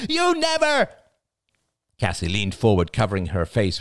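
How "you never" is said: put-on very high toddler shriek; screaming. A narrator's reading. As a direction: keep the tantrum going. Toddler tantrum continues.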